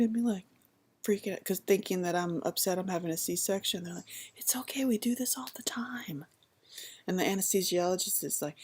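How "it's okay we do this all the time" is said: soft, high unvoiced whisper; calm her down gently; soothing nurses. Nurses soothe the crying patient, whispered.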